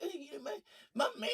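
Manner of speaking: imitating [name]. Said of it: "[name]'s just talking shit".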